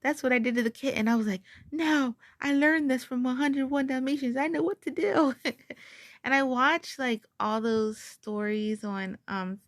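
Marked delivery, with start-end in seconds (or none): 1.7-5.3 high, loud and eager; bursting with confidence; excited. Retold or imitated. Retelling confident rescue attempt.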